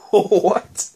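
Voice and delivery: high-pitched, stunned what; incredulous. Retold or imitated. Shocked viewer hears Norma's insult, incredulous. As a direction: react in mock disbelief. I'm sitting here like.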